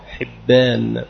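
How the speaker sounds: حبان as ḥibbān, kasra on ح and a doubled b; careful pronunciation. Shown as a demonstration. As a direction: say حبان with ḥi and a held bb. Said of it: "بكسر الحاء المهملة وبالباء الموحدة". (حِبّان بكسر الحاء وتشديد الباء)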